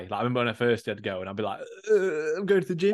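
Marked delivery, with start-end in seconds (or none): 1.9-2.9 sheepish fib. Retold or imitated. Lying to the campus gate guard.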